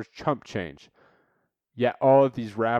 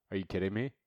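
ordinary talk

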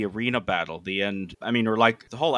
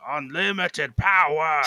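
slow, raised villain voice, hammed-up; Palpatine impression; 6 semitones higher, 4 dB louder, slower than usual. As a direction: ham up the evil emperor line. Imitating Palpatine's famous line.

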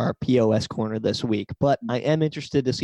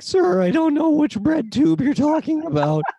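put-on high nasal-free teen clerk voice, much higher; squeaky nervous teenage clerk; Simpsons teen voice; 12 semitones higher than usual. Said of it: "sixteen-year-old from The Simpsons will be like".

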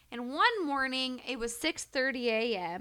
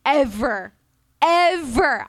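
louder and louder on each ever; emphatic insistence; 4 semitones higher, 10 dB louder than usual. Real, not acted. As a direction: swear to them it never happened, building. Insisting she never blacked out.

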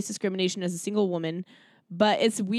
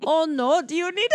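high, loud attempt at a Minnesota accent; hammed-up Minnesota accent, on the verge of laughing; mock Minnesota accent, pitched way up; Siobhan; 9 semitones higher, 5 dB louder than usual. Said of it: "let me do her accent".

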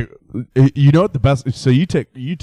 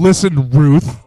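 mock stern; 8 dB louder, slower than usual. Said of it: "And you're like".

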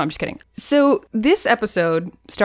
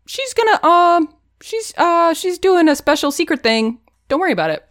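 a much higher, hammed-up cagey voice; mock evasive official; 9 semitones higher, 3 dB louder than usual. Palace officials dodging questions about the Queen, high and cagey. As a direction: fob them off brightly.

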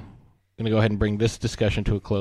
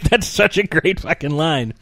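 ordinary talk, nothing marked

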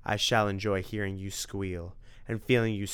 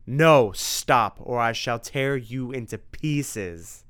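loud desperate vampire; 3 semitones higher, 7 dB louder, slower than usual. Acted. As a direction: shout it, then slow threat. Dracula begging then threatening.

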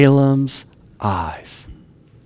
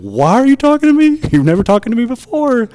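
much higher, incredulous voice; astonished question; 13 semitones higher, 5 dB louder than usual. Retold or imitated. Preacher imagining Balaam's startled question, high and incredulous. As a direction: play the shocked man who should have asked.

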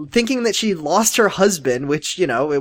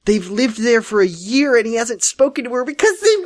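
climbing high into disbelief; real exasperation, pitch rising steeply; exasperated rant; 10 semitones higher than usual. Real, not acted. Ranting about absurd story plot.

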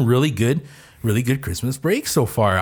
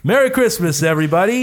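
cheerful greeting; 6 semitones higher, 3 dB louder than usual. Acted. Festive greeting opening the show.

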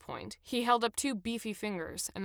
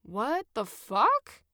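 bemused; 3 semitones higher, 5 dB louder, much slower than usual. A narrator's reading. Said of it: "far more bemused than upset".

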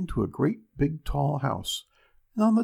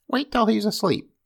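ogre's wife; 8 semitones higher, 4 dB louder than usual. Acted. Wife whispering to Jack.

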